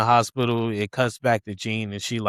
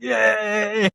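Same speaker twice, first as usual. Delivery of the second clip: high, drawn-out celebratory cheer; mock cheering; 9 semitones higher than usual. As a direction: imitate someone cheering on from a window. Acts out Punisher cheering from window.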